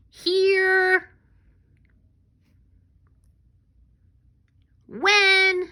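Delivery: high, loud yelled word; big yell. Shown as a demonstration. Teacher leading kids through sight words, big yell. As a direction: belt each word out high and long. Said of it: "Give a big yell".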